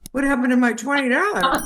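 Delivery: put-on dismayed child. Child dismayed at losing her earnings.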